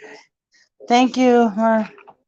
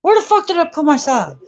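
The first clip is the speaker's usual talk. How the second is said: high, loud frustrated outburst; exasperated; 11 semitones higher, 5 dB louder, faster than usual. Real, not acted. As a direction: yell it out in exasperation. Searching for socks, exasperated.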